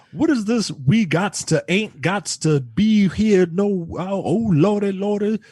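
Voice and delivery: mock script dialect. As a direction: read it out in exaggerated, incredulous dialect. Mockingly reads out the script's dialect lines.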